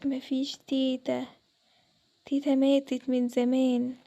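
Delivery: low, slow, eerie ghostly warning. Acted. Unseen ghost calling the girls at night, low and slow. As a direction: draw every word out like a spirit in the dark.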